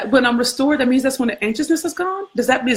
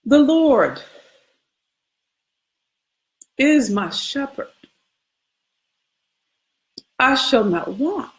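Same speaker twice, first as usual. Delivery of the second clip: solemn scripture recital; slower than usual. Acted. Slow scripture recital with pauses.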